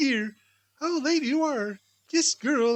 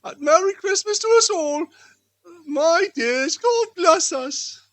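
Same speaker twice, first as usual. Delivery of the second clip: festive toast; 5 semitones higher, 6 dB louder than usual. Acted. Family Christmas toast.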